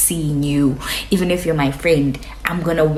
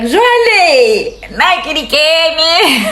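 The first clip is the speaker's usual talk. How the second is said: high, sing-song taunt; mocking inner voice; 9 semitones higher, 5 dB louder, slower than usual. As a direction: tease it in your head, playfully. Thinking it in her head.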